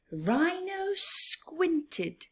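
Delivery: high, slow and drawn out; high slow squinty read; squinting demo. Shown as a demonstration. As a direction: stretch it high to show squinting. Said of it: "This is squinting".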